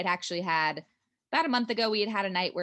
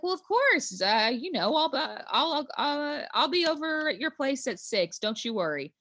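high, mock Southern boyfriend voice; put-on Southern politeness, pitched up; awful Southern accent; 7 semitones higher than usual. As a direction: imitate him in an overdone Southern accent. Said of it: "with his awful Southern voice".

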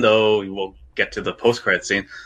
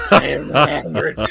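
laughing villain imitation; 4 semitones higher than usual.

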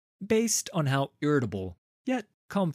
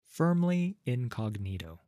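plain reading voice

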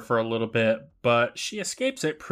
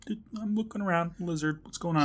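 higher, softer, quick lizard voice; chilled lizard; 6 semitones higher, 4 dB quieter, faster than usual. Acted. Host voices the idle lizard to co-host, chilled lizard voice. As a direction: play the lizard casually glancing about, pitched up.

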